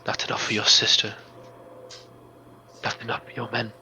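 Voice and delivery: a grave priestess asking about his faith; the mystic Meningian testing the hero, hushed and grave; solemn mystic. Acted.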